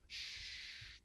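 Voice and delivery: a soft hushing shh; shushing sound. Shown as a demonstration. Preacher shushing a noisy room. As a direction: shush someone to quiet them. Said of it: "we use the word to hush".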